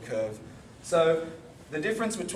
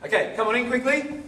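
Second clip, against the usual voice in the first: raised voice calling the class back in; 8 semitones higher, 5 dB louder than usual. Real, not acted. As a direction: call them in loudly and quickly.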